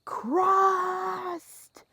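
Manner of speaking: high mock scream of a single word; screamed repeat. Retelling the patient's scream, pitched high and loud. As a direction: yell it again like the boy did. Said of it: "screamed it".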